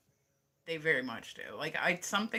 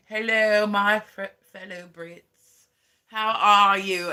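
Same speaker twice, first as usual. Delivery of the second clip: higher, louder posh British royal address; hammed-up Queen greeting her subjects in a posh British accent; mock Queen's speech; 3 semitones higher, 11 dB louder than usual. Imitating the Queen addressing fellow Brits, mock posh British accent. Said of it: "Like, when the Queen gets up".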